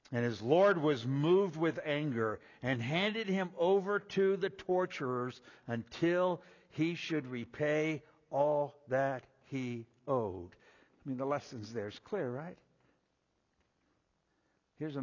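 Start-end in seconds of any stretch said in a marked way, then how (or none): 0.1-10.5 raised, measured reading of the parable; scripture reading. Acted. Preacher reading scripture to the congregation, raised and measured.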